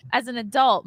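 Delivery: ordinary delivery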